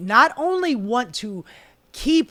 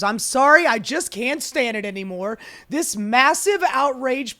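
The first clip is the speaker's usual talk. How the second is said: higher, louder, exasperated outburst; Elon Musk; 4 semitones higher, 3 dB louder than usual. Retold or imitated. Host quoting billionaire's attack on spending bill, exasperated. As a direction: act out a fed-up public denunciation. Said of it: "Elon Musk comes out and he goes".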